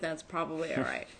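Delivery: ordinary talk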